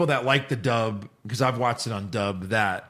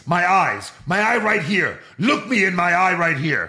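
higher, insistent dub line; over-explaining dub voice; 5 semitones higher, 4 dB louder than usual. Retold or imitated. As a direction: overdo it like a clumsy dub actor. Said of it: "You couldn't think of other ways".

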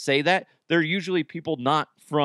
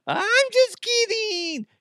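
very high, slow, stretched squeal; breaking into kidding; 4 dB louder, much slower than usual. Shown as a demonstration. Radio host breaking out of his accent bit, high squealed kidding. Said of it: "Then I would always break into".

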